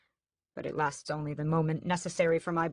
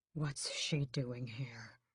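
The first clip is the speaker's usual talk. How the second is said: slow, softer hissed whisper; hissing; 4 semitones lower, 8 dB quieter, much slower than usual. A narrator's reading. Raoul hissing to brother about mother. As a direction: hiss it warily. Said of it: "he hisses".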